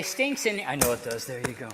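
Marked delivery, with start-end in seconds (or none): 0.0-0.7 much higher, louder muffled-in-suitcase puppet; ventriloquist's puppet moaning about the smell; loud high puppet complaining. Acted.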